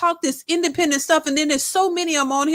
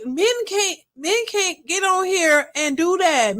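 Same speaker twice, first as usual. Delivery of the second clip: usual speaking voice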